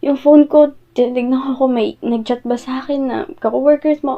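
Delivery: ordinary talk, nothing marked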